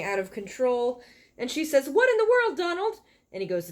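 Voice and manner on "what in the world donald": high, loud alarmed cry; shocked as the vacuum runs wild; a much higher, louder shocked call to her husband. Acted. Vacuum goes out of control.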